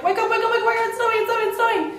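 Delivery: high, fast, repeated calls to wake everyone; bursting with joy to get them up; excited wake-up call. Retold or imitated. Waking housemates for snow.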